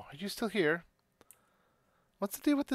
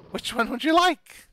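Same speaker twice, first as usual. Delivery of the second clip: tiny excited voice; 8 semitones higher, 8 dB louder than usual. Acted. Excited shopkeeper.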